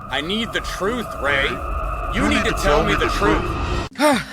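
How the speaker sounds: distorted thundering demand; booming demand. Acted. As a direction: roar the demand like a nightmare voice. Dream figure repeats, now booming.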